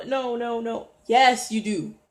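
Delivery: ordinary talk, nothing marked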